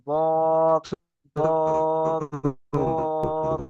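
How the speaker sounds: each বদ held long on a level note; steady, stretched বদ, a short break after each; articulation demo. Shown as a demonstration. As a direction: repeat বদ as drawn-out drill sounds. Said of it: "মাড়ির গোড়ায় গিয়ে লাগবে".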